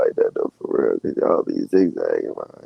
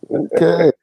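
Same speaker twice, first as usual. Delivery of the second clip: high mock okay; 11 semitones higher, 4 dB louder than usual. Mock backing off.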